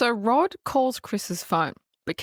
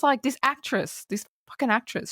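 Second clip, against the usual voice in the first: ordinary delivery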